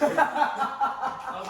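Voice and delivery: normal talking voice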